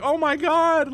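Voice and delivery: high, loud mock wail; imitated crying. Friend crying over the broken door, mock wail. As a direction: wail it like an upset kid. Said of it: "He was crying 'cause he was like".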